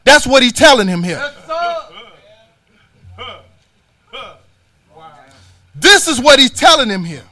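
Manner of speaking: loud, rising preacher's rebuke; preaching at full force. Acted. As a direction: hammer it home from the pulpit. Preacher voicing prophet's rebuke.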